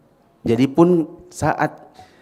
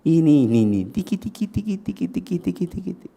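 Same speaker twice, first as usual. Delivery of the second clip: high, soft tickle chant; rapid sing-song "tiki tiki" at a small child; playful tickling; 5 semitones higher, 4 dB quieter than usual. Retold or imitated. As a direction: imitate an adult tickling a toddler. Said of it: "yang tidak hormat gini".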